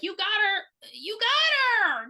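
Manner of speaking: slow, high, loud egging on; drawn-out urging. Retold or imitated. Viewer egging the entity on, slow loud high call. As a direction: stretch it out, urging the monster to finish her.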